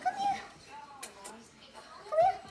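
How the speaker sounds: very high coaxing call. Real, not acted. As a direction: call the puppy sweetly. Calling the puppy.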